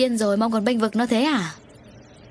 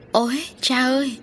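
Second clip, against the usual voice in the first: high acted cry for help; terrified shriek; 7 semitones higher than usual. A narrator's reading. Girl calling father to save her, acted terrified cry. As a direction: cry out in panic, voice pitched high but not screamed. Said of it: "Hồng Hạnh rú lên".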